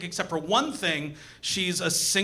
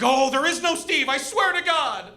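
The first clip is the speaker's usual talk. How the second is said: pleading; 8 semitones higher than usual. Retold or imitated. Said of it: "She'll be like".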